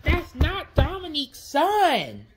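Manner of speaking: loud, high and slow; angry slow shout. Acted. Mario objects to calling it Dominique's son, slow angry yell. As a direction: shout each word out.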